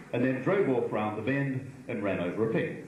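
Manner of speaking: normal talking voice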